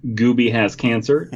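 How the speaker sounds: ordinary talk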